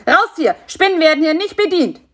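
high, loud, fast panicked shouting; a frightened cricket yelling from hiding. A narrator's reading. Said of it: "rief er aus seinem Versteck".

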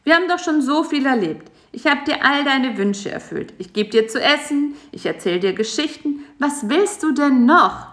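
a hint of an exasperated spider. A narrator's reading. Spider fed up with the fly, mildly exasperated. Karl-Heinz voiced.

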